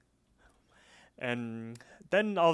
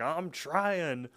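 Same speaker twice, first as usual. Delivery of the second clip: strained mock protest, pitched up; Dom; 4 semitones higher than usual. The driver answering the yelling passenger, higher mock protest. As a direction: whine it back, drawn out. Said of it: "And he's like".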